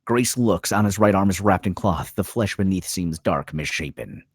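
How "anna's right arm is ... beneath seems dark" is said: ordinary talk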